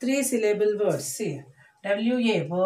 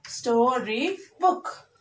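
STORY BOOK slowly, in three separate beats; sto, ry, book for STORY BOOK, pausing between; split into syllables; 3 dB quieter, much slower than usual. Shown as a demonstration. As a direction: break STORY BOOK up for a class. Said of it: "Like this".

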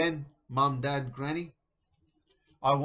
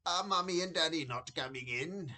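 higher-pitched granny voice, slightly let down; crestfallen granny; 4 semitones higher than usual. A narrator's reading. Granny asks grandson after his parents, a little crestfallen. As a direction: ask as a doting granny, a little disappointed. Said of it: "a little crestfallen".

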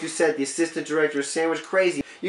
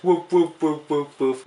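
mock dog barks; 5 semitones lower, slower than usual. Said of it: "Love it when that dog barks".

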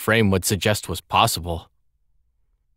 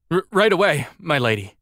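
high, stammered start dropping toward normal pitch; flustered compliance; 8 semitones higher than usual. A narrator's reading. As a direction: stammer obediently to your angry mistress. Scolded butler hurries to obey.

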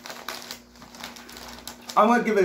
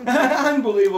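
laughing through the word; giggly disbelief; 7 semitones higher, 3 dB louder than usual. Real, not acted. Laughing at fiber joke.

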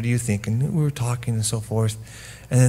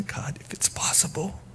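soft, high whispered prayer; 8 semitones higher, 9 dB quieter than usual. Acted. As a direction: pray it under the breath. Preacher voicing Jesus praying.

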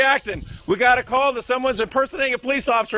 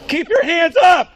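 shouting; 5 semitones higher, 5 dB louder than usual. Real, not acted. Officer warns suspect at gun.

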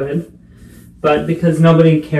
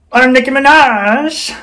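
higher, slow, drawn-out diva announcement; 8 semitones higher, slower than usual. Retold or imitated. Vlogger announcing herself as a sassy diva, higher and slow. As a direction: strike a pose with a sassy diva voice. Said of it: "Remember my [name] roleplay?".

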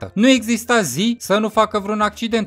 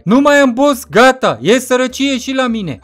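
loud protest; 5 semitones higher, 5 dB louder than usual. Acted. Character protests loudly about money.

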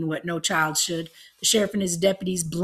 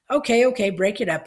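gruff order; 4 semitones higher, 6 dB louder than usual. A narrator's reading. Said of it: "he said, elbowing through".

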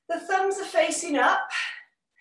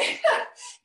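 giggling at herself, high; a high unvoiced laugh; high breathy laugh; 13 semitones higher than usual. Real, not acted. Laughs at own bingo call.

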